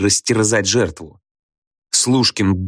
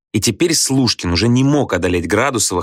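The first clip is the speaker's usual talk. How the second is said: ordinary delivery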